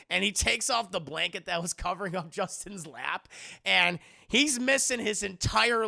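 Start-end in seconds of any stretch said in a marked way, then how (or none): none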